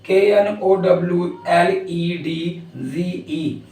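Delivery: slow, deliberate letter-by-letter spelling; reading the spelling aloud, one letter at a time; spelled out letter by letter. Shown as a demonstration. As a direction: spell each letter out slowly and clearly. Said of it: "ये लिखा हुआ है".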